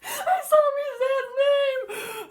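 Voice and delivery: high squealing, laughing overwhelm; loud gush climbing into a teary squeal; real giddy meltdown, barely getting words out; too excited. Real, not acted. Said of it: "I'm getting too excited".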